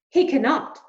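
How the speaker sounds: loud, fast, exasperated father; despairing protest. Acted. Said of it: "Lester said".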